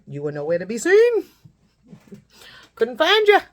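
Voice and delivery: mock yell, climbing high and loud at the end; half-laughing shout back at the other mom; retold yelling back. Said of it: "now I'm yelling back at her".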